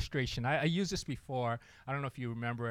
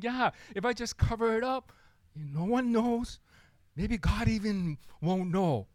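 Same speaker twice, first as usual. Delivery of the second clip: self-deceiving sinner; 6 semitones higher, 3 dB louder than usual. Acted. Sinner voiced, hiding sin.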